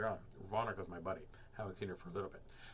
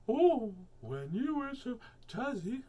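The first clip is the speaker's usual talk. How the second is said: mock Yasminka voice; 11 semitones higher, 5 dB louder, slower than usual. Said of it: "It's like".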